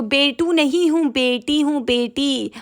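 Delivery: mock childish insistence, falling at each phrase; little girl's correction, imitated. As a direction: imitate a small girl correcting her teacher. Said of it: "तो बोल रही है कि".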